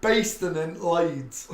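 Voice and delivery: hammed-up Leeds accent, stretched slowly; thick Leeds accent. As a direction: imitate a broad Leeds girl correcting you. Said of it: "her accent was so thick from Leeds".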